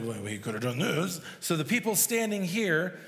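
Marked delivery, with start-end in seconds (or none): 0.0-1.2 lightly mocked low grumbling doubters. Jesus addressing doubters at the tomb, lightly mocked doubter voice. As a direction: imitate doubters in a lowered voice. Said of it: "even you doubters".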